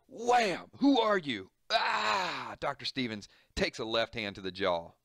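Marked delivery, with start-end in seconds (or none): 0.1-0.6 high, loud wham sound effect; comic-book hit sound, shouted; punch sound effect. Acted. 0.8-1.5 high, loud startled demand; confused patient shouting at the doctor. Acted. 1.7-2.5 high yelp of pain; a sharp cry as the punch lands; doctor crying out when struck. Acted. Doctor hit in the jaw.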